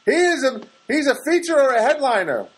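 high, loud insistent objection; mock outraged hospital spokesperson; hospital arguing. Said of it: "That's the hospital talking".

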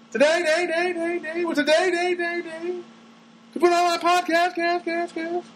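high sung echo fading on each repeat; mock echo. Announcing his podcast with a mock echo. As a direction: fake a reverb echo on the words.

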